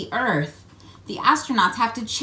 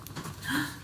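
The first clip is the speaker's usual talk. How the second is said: mock gasp; 5 semitones higher, 10 dB quieter than usual. Discovering the hidden picture.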